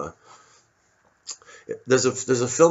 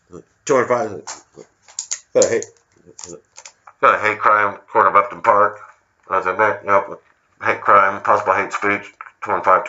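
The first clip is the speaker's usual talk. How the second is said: loud mock radio dispatch; hammed-up police radio call; 6 dB louder than usual. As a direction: report it like a cop on the radio.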